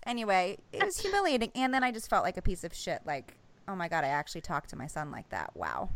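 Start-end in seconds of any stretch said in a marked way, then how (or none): 3.7-5.9 quiet guilty self-talk. Retold or imitated. Inner guilty thought.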